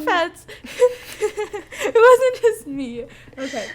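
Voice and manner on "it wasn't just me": laughing. Real, not acted. Defends herself while laughing.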